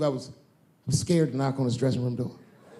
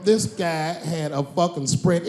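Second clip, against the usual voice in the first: ordinary talk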